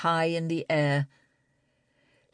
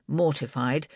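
ordinary delivery, nothing marked

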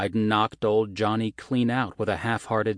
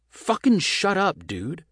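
loud snap; 12 semitones higher, 5 dB louder than usual. Acted. Ryan shuts down Johnny.

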